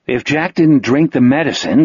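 ordinary delivery

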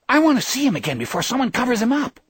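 put-on high, rapid girlish outburst; sudden yell. A narrator's reading. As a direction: blurt it out fast and high, all eagerness. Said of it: "Debbie yelled suddenly".

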